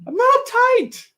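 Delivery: very high, loud denial; indignant yell. Real, not acted. Host denying to co-host being uptight, indignant high yell. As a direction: shout it back in squealing protest.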